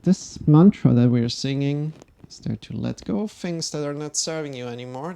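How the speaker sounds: normal talking voice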